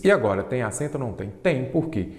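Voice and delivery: usual speaking voice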